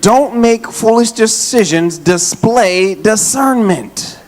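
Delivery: very slow, spaced-out exhortation; slow emphatic. Real, not acted. Preacher urging church, slow and deliberate. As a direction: lean on each word slowly. Sermon point, drawn out.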